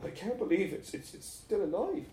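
high, faltering voice, stumbling in shock; weakly. A narrator's reading. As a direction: say it feebly, barely believing it. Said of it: "said Cian weakly".

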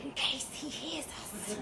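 hushed whisper in bed. Acted. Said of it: "why are you whispering?".

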